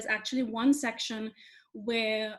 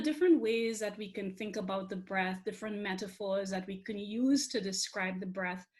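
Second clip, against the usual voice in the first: ordinary delivery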